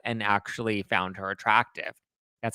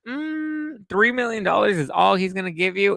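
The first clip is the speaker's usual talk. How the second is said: much higher, then louder mocking disbelief; incredulous scoff; 10 semitones higher, 4 dB louder than usual. Real, not acted. Vlogger scoffing at Hef's will, high incredulous mockery. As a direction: scoff at the tiny sum in disbelief. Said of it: "My first thought was".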